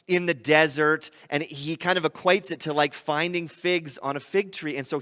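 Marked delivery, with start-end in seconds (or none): none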